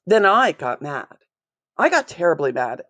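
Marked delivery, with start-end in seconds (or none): none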